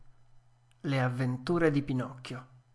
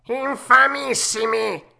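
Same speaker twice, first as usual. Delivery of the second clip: high, loud cat outburst; cat's echo; 10 semitones higher, 9 dB louder, slower than usual. A narrator's reading. Cat echoing the fox, loud and high. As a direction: top the fox, louder. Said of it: "ripeté il gatto".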